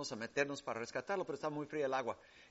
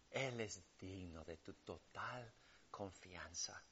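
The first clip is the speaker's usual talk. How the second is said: tender assurance; 7 semitones lower, 4 dB quieter than usual. Acted. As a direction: lay the comfort down gently. Tender appeal, low and slow. (voz tierna y pausada)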